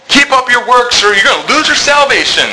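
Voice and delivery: mocking a preacher who threatens lost salvation. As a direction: lampoon a works-salvation sermon. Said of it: "if they're saying".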